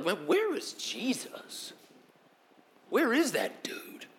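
slow, soft, whispered wondering; half-whispered, peering around in the dark; hushed searching. Retold or imitated. Disciples whispering as they look for Jesus.

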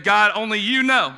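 preacher repeating Ezekiel's reply with force; emphatic reply. Retold or imitated. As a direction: proclaim the humble answer.

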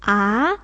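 啊 rising like a question; second tone. Shown as a demonstration. Teacher answering about the second tone, rising 啊. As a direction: lift 啊 upward into a doubting question. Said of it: "How about the second tone?".

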